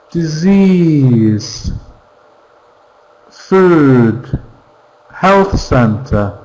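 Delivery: each word said on its own, slow and careful, for the class to repeat; model word drill. Shown as a demonstration. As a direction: read the vocabulary list like a pronunciation model. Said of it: "I'm going to read in English".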